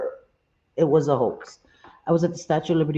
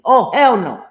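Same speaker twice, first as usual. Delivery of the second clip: mock alarmed exclamation; 4 semitones higher, 7 dB louder than usual. Retelling seeing a ghost grandma.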